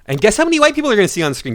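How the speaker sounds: loud, high-pitched rebuke; shouted taunt. Pretend. Telling complainers about screen diversity to move away, loud mocking shout.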